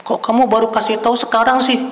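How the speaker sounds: high, fast, indignant outburst; angry husband. Retold or imitated. Husband scolding his wife over the late news, angry outburst. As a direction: snap at her for telling him only now. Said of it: "marah suaminya".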